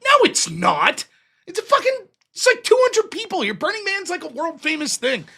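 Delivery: high, incredulous mock protest; scornful objection. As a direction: act out a drunk man's scornful rebuttal. Retelling his scornful argument with hippie.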